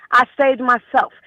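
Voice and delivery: ordinary talk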